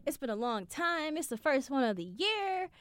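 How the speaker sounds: high, upbeat happy chatter; bubbly and pleased with herself; excited self. Retold or imitated. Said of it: "So I'm like".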